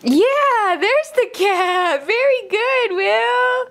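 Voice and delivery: excited praise. Real, not acted. Praising toddler.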